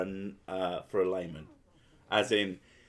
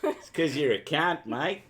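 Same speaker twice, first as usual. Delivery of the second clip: higher-pitched Aussie teasing; put-on Aussie banter; mock Australian accent; 5 semitones higher than usual. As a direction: tease in a broad Australian accent. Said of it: "you do Aussie well".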